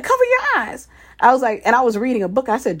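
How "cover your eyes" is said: imitating a small boy, much higher; high insistent little kid; child's voice. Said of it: "He's like".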